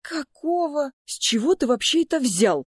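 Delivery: high, outraged questioning; getting angry. A narrator's reading. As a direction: demand it with rising temper. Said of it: "начинал злиться Лео". (возмущённо, начиная злиться)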